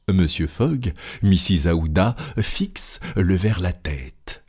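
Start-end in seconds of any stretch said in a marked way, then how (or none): none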